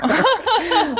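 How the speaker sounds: high, pained laugh; real, pitying laugh. Real, not acted. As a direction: burst into high laughter at someone's naive hope. Laughs in pity at Gore.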